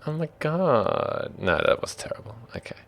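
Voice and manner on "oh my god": put-on high, drawn-out Valley Girl. Game master botches an impression, sounding Valley Girl. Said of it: "That was more Valley Girl".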